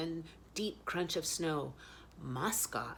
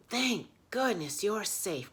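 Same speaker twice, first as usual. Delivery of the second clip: relieved cry; 6 semitones higher, 6 dB louder than usual. Acted. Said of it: "cried Badger".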